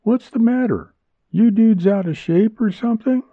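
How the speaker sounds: high, cheery woman's voice; cheerful ribbing in a high character voice; cheerful teasing. A narrator's reading. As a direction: tease the men cheerfully, pitched high. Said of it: "Junella said cheerfully".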